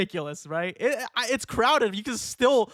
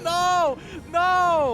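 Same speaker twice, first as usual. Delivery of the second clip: high, loud no no; loud refusal; 10 semitones higher, 3 dB louder than usual. Real, not acted. Refusing to keep gripping, loud high no. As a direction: protest at the choice.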